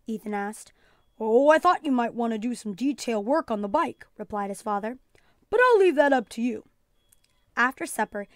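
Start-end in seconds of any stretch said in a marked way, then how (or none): 1.2-6.7 a lightly voiced kind dad, easygoing; just a hint of a relaxed father offering help; fatherly voice. A narrator's reading. Father's voice in story.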